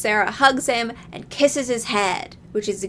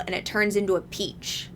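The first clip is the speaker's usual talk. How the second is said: usual speaking voice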